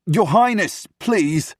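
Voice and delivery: high, loud, frantic call; alarmed plea. Acted. Secretary pleading as the prince barges in, alarmed plea. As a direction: beg him loudly to stop.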